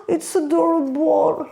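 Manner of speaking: high gasp into a gushing coo; adoring gush. Real, not acted. Vlogger finding the bag perfect for her, adoring gush.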